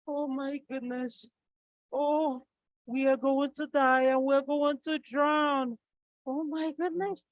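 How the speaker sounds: a hammed-up scared wife in the boat; panicking wife. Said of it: "She was panicking".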